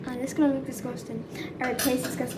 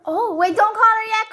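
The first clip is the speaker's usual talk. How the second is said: ordinary delivery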